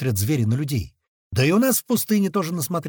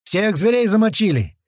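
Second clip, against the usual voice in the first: louder, higher triumphant report; radio shout; 7 semitones higher, 5 dB louder than usual. A narrator's reading. Mage reporting the beasts killed over the radio, shouting. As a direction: call out the kill over the radio. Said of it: "снова кричит в рацию мага".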